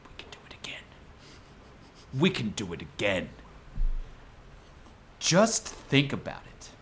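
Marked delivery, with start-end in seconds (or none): 0.1-0.8 breathy whispered repeat. Acted. 2.2-3.3 rousing, building political speech; rally the people to rise up; stirring speech. Acted. Impassioned closing speech.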